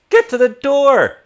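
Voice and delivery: high, loud shouted command; Elsa, wrong voice. Acted. Game master voicing a woman fighting skeletons, high shouted order. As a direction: shout it high and hard, a first try at the character. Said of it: "that's not how she sounds".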